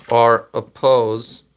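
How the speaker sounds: halting, one word at a time with long pauses; word-by-word dictation. Real, not acted. Streamer typing up a poll for viewers, word by word.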